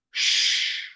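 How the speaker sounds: a sharp unvoiced shush; guard's stern shush. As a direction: hush her like a guard.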